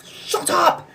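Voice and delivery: high-pitched retort to noisy neighbors; high, strained "shut up" in exasperation; exasperated outburst. Retold or imitated. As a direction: snap at the neighbors without raising volume. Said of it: "my neighbors are shouting and screaming".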